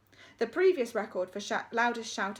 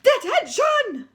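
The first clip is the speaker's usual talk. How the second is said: high, loud drawn-out bellow; deputy head screaming the punishment; furious shout; 14 dB louder, much slower than usual. Acted. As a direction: roar detention at the school. Deputy head's outburst.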